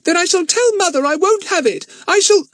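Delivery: put-on high, loud angry girl; losing temper. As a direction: snap it, temper rising. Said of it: "Dinah began to lose her temper".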